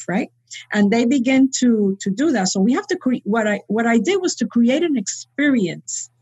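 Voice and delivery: ordinary talk, nothing marked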